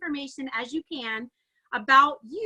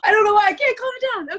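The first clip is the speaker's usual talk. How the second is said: much higher and louder, bursting with real excitement; can't contain the thrill, words spilling out; breathless excitement; 8 semitones higher, 11 dB louder, faster than usual. Real, not acted. Coach to her team, too excited to calm down, breathless and bursting. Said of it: "out of breath 'cause I'm so excited".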